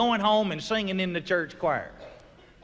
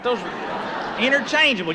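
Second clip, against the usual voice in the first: usual speaking voice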